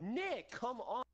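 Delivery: high, drawn-out mock protest; retold exasperated protest. Retelling how friends scolded Nick, high exasperated protest. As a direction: stretch it out high, chiding a friend.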